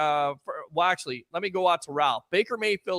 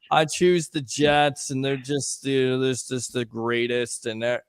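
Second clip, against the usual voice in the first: mock monotone; 3 semitones lower than usual.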